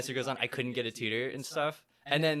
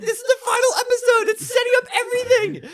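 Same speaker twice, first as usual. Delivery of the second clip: much higher, louder, near-tearful mock mom; mom gushing; 13 dB louder than usual. Imitating mom over final episode, high tearful gush. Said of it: "She's like".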